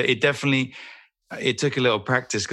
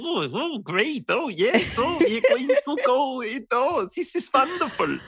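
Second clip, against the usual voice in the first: put-on very high, singsong German-accented voice; hammed-up German accent, pitched way up; Taika's character's German accent. Actor imitating the film vampire's accent, high German-accented lilt. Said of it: "his, uh, accent is more German".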